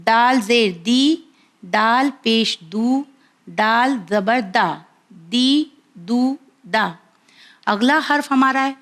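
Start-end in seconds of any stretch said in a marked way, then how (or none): 0.1-6.9 دال زبر دا said slow and clear; letter drill. Shown as a demonstration. Teacher drills viewers on دال, slow and clear. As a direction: spell out دا دی دو, the last line slower. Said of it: "next حرف کی طرف دیکھیں".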